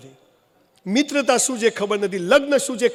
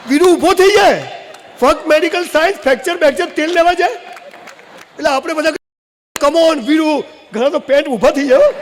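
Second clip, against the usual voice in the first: excited; 7 semitones higher, 6 dB louder than usual. Acted. Hero rises, crowd cheers.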